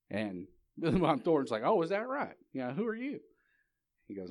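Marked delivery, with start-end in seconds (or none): 1.6-3.2 high-pitched, challenging retort; mock belligerent dealer. Card dealer answers Wyatt Earp, challenging. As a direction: act out a riled bully sizing up a stranger.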